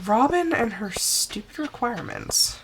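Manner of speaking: normal talking voice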